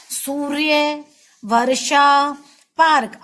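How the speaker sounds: सूर्य, वर्षा, पार्क each said crisply with the r cluster; सूर्य वर्षा पार्क said cleanly, one word at a time; clear model words. Shown as a demonstration. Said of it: "इसको बार बार आप बोलोगे".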